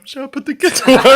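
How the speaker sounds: high laughing imitation of a Brit welcoming aliens; mock British host. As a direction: squeal it through laughter as a Brit greeting aliens. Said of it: "just the aliens come".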